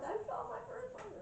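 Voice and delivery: very high, mock-elated squeal; excited retold cry. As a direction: imitate overjoyed news in a high voice. Same woman's news, repeated while hugging.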